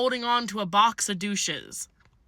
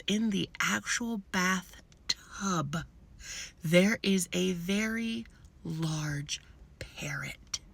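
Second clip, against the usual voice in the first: whisper; 2 semitones lower, 7 dB quieter, much slower than usual. Acted. Hushed suspense in bathroom story.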